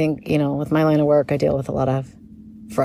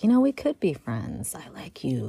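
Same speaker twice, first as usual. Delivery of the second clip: whispering; 6 semitones lower, 6 dB quieter than usual. Retold or imitated. Whispered thought about stranger online.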